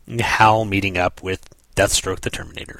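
ordinary delivery

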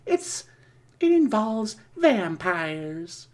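high, teasing ghoulish voice; Crypt Keeper voice. Acted. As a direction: tease like a playful ghoul. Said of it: "Crypt Keeper here".

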